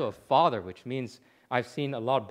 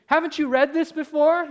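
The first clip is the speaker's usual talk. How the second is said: very high, loud incredulous question; astonished question; 13 semitones higher, 7 dB louder than usual. Retold or imitated. New convert points the preacher to the verse, astonished question. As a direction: cry it out in amazement.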